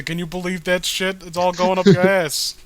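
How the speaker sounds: normal talking voice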